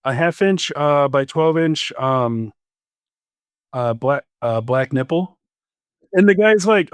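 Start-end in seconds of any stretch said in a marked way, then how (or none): 0.1-5.4 hesitant, mildly sheepish order with ums; halting, as if embarrassed to ask; imitated sheepishness. New guy ordering at supply counter, mildly sheepish. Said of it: "all sheepishly".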